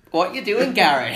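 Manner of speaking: mock Australian calling Gary the goat; Australian accent. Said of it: "What was that?".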